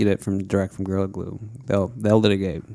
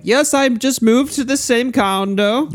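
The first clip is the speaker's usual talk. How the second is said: much higher, slow fake voice; disguised voice; 12 semitones higher, slower than usual. Scamming Amazon with a changed voice, high and slow. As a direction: put on a silly disguise voice. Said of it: "keep changing your voice and, like, saying".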